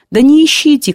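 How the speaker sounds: put-on old man calling out sharply; called after him. A narrator's reading. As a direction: shout it after him as he walks off. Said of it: "крикнул ему сзади старик".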